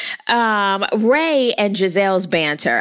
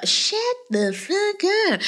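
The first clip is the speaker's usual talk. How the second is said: exasperated; 10 semitones higher, slower than usual. Pretend. Telling Mia off.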